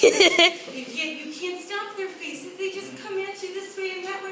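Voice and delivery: usual speaking voice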